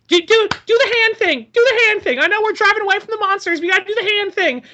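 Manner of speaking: high, loud, rapid mock plea; frantic pleading. Acting a movie character pestering a driver, frantic pleading. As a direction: rattle it out high and insistent.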